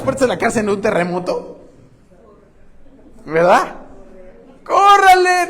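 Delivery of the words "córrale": high, loud cry to run; mock shout. Prisoner urging the others to flee, shouting. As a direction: yell at everyone to make a run for it. Prisoners fleeing the open jail.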